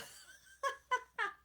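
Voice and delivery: high, loud burst of laughter. Real, not acted. Teacher laughing at a child's sticky tape joke, high laugh.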